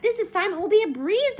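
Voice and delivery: high, loud, breezy enthusiasm; excited confidence. A narrator's reading. Child sure of the assignment, excited. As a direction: declare it eagerly.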